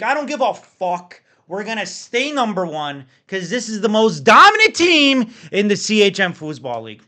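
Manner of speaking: rally the league like a triumphant champion; roaring rallying speech. Acted. Hype rant about his dominant team.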